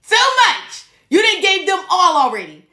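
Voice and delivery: high, loud exclaim of disbelief; exasperated exclaim. Real, not acted. Podcaster exclaiming to listeners about overinvesting. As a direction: cry out in disbelief at how much was given.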